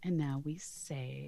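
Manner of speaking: soft unvoiced whisper. Real, not acted. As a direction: whisper it aside. Recording aside, whispered.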